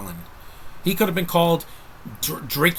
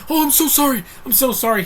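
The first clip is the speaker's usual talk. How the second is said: much higher and louder, a flustered, gushing apology; Tom Holland apology impression; 13 semitones higher, 6 dB louder than usual. Reviewer imitating Tom Holland's flustered reactions, high-pitched and loud. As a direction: squeal out a panicked sorry like a clumsy young hero. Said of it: "or saying".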